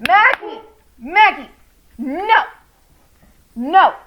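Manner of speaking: loud sharp calls to the puppy; yelling at the dog. Real, not acted. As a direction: shout her name to stop the barking.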